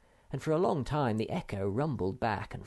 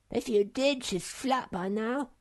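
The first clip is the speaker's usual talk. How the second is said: callous little creature voice; unmoved; 13 semitones higher than usual. A narrator's reading. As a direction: shrug it off. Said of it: "said Sniff, quite unmoved".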